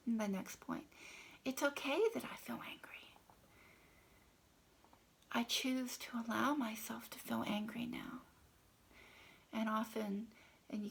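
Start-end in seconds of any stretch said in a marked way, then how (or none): 1.4-8.2 hushed, intimate affirmation read; tapping affirmation. Shown as a demonstration. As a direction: murmur it gently to yourself while tapping. Tapping script demo.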